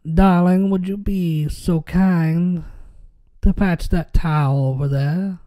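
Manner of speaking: put-on slow, drawn-out old Southern belle talk; old Southern drawl. Old Southerner asking darling for a towel in the heat, Southern drawl. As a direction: put on a leisurely old-time Southern accent. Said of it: "the old southern people".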